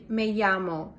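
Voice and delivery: llamo said with a y sound, "yamo"; Mexican Y sound. Shown as a demonstration. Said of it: "It's like Y sound".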